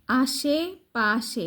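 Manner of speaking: আশেপাশে stretched very slowly, আশে and পাশে apart; very slow split word. Shown as a demonstration. Teacher showing a child two separate parts, very slowly. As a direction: drag out আশেপাশে in two halves. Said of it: "দুইটা আলাদা উচ্চারণ করবা".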